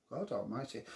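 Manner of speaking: ordinary talk, nothing marked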